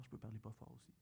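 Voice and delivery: soft, hushed voice; speaking softly. Shown as a demonstration. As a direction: drop the voice right down. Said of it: "Vous voulez que je parle pas fort".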